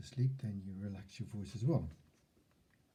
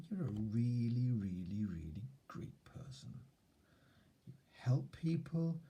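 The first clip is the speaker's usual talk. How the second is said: soothing whisper. Shown as a demonstration. Consoling a six-year-old self with stressed whispers. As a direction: coax a shy child gently, in a whisper. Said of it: "that last phrase would be along the lines of".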